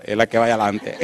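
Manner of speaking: words spilling through a laugh; can barely get it out for laughing. Retold or imitated. Preacher retelling the joke on the street, laughing. Pointing men to the woman.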